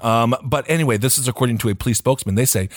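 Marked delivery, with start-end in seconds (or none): none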